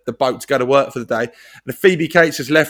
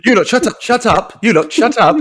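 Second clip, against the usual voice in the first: higher, bratty yelled order; Rik Mayall impression; 6 semitones higher than usual. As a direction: snap at the class like a sitcom schoolboy. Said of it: "descended into Rick Mail from Bottom impression".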